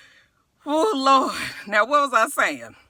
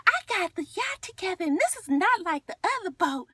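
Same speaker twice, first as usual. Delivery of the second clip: high, soft put-on voice; Portia imitation; 7 semitones higher, 6 dB quieter than usual. Castmate announcing the yacht cruise, high soft imitation. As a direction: lift it high and small, imitating her.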